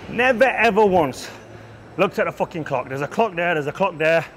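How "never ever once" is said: never ever once, each word leaned on, slower and louder; slow emphatic stress. Real, not acted. Coach insisting to viewers he never times rests, slow emphatic stress.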